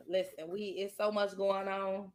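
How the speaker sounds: ordinary talk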